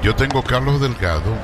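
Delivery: ordinary talk, nothing marked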